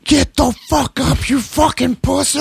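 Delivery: high, strained drill-sergeant yell, held back in volume; imitated full-on yelling. Rock stars yelling at him flat on the ground, imitated drill-sergeant yell. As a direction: stretch it out like a screaming drill sergeant. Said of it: "They're just yelling at me, like, full on".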